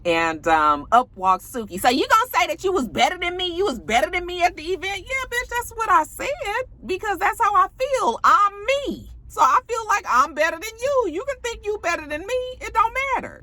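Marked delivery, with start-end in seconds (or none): 1.8-5.0 high, fast, confrontational challenge. Retold or imitated. Vlogger imitating castmate confronting a rival rapper, high mock indignation. 5.0-13.4 high-pitched sassy defiance. Retold or imitated. Vlogger imitating rapper's retort to a rival, high mock defiance.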